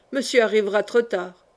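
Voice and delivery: ordinary delivery, nothing marked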